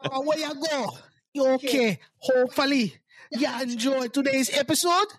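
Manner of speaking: hammed-up Jamaican sign-off; Jamaican accent. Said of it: "say goodbye in his Jamaican accent".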